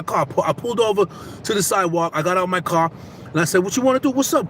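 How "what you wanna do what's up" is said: high-pitched challenge, quick and punchy; mock squaring up to a driver; retold confrontation. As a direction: call him out, retold without real shouting. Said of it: "he was, he was yelling".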